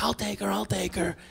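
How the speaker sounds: imitating a wife's hushed offer. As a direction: say it quietly so as not to rile the baby. Wife quietly takes over baby.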